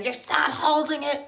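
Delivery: high, loud imitation of a yelling dad; mock a dad yelling the same correction. Golf dad at the kid's next try, mock yelling. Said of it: "yelling at his kid".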